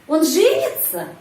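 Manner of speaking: женится rising sharply then falling; question stress on verb. Shown as a demonstration. Teacher asking whether he will marry, question stress on женится. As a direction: ask by punching up женится. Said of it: "Apakah dia akan menikah".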